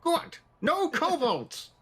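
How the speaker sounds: put-on high, narrow-eyed old woman; suspicious old barmaid. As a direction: squint and question, high. Dana bans kobolds.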